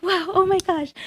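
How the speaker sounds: overjoyed exclamation; thrilled gasp. Retold or imitated. Running downstairs after the news.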